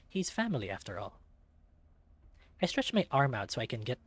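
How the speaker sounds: plain reading voice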